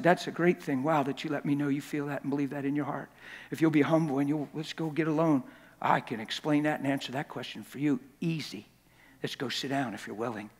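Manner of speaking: soft, hushed and calm; quietly calm an upset teen; gentle reassurance. Retold or imitated. Pastor answers angry teenager gently.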